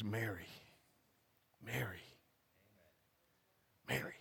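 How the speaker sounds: hammed-up tender call of her name; melodramatic. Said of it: "we have the melodramatic".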